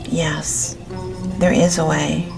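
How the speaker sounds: ordinary delivery, nothing marked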